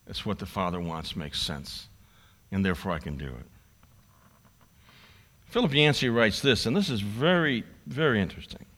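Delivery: ordinary talk, nothing marked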